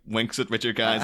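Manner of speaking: ordinary talk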